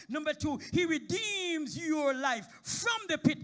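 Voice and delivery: high, loud, slow tearful holler; shouting praise with a sob in it; crying-out preaching. Real, not acted. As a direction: cry it out to the church.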